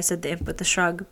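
ordinary delivery

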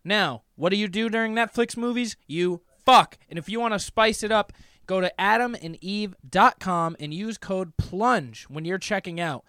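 hammed-up sponsor read. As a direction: pitch the promo code. Sponsor read mid-show.

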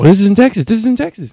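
high, loud and thrilled; real excitement, voice jumping up; excited discovery. Real, not acted. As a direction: burst out with the good news. Discovers the match is nearby.

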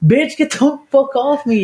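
high-pitched mock outrage; retold indignant rebuff. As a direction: push them away with a squealed protest. Retold rebuff of face-licking drunk.

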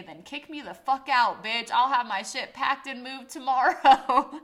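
loud, high-pitched retold comeback; escalating retort. She escalates the fight with roommate, angry shouting retold with amusement. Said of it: "I gotta escalate it and say".

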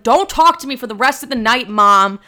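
hammed-up storming-off line to mom; sister's huffy outburst. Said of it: "Laura was like".

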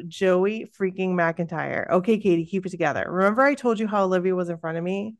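normal talking voice

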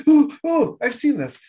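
a movie star pointing at the screen, thrilled to spot something; Leonardo DiCaprio impression. Host imitating an actor recognising a scene, squealing excitedly. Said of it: "my Leonardo DiCaprio impression".

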